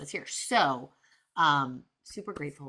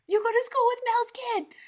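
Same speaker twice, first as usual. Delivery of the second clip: very high, louder, giddy exclamation; playfully re-enacted fangirl excitement; thrilled mock squeal; 5 dB louder than usual. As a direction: squeal the big news at your daughter. Retold excited news to daughter.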